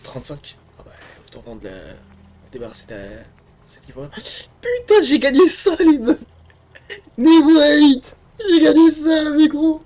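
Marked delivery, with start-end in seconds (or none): 4.6-9.8 high loud shout of triumph; excited yell. Real, not acted. Gamer screaming with joy at winning the loot, excited yell.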